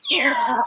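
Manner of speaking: high squeaky sound effect; imitated splat noise. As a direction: mimic pizza hitting a TV with a squeal. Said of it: "And it went, ... like that".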